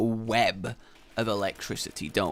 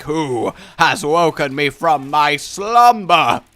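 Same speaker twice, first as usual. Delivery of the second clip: slow, louder monster voice; ancient beast; 2 semitones higher, 8 dB louder, slower than usual. Retold or imitated. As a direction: rumble it like a woken giant. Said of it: "I was like".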